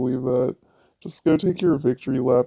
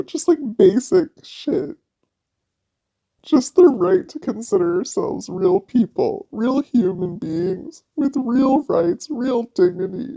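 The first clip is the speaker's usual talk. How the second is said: rising outrage; 7 semitones higher, 3 dB louder than usual. Real, not acted. Ranting about women's basic rights.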